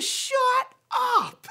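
high, loud mock shriek; astonished disbelief. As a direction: imitate a stunned man, split into two big beats. Homeless man's retold astonishment.

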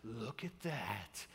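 low, soft, slow announcer voice; imitating a kid gone hushed announcer; radio announcer. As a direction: intone it slowly like an awestruck radio announcer. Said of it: "voice turned into a radio announcer".

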